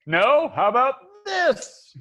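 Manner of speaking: high, loud, drawn-out taunt; hammed-up challenge, high and slow; mock defiance. GM imitating player standing up there, high hammed-up defiance. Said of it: "you're like".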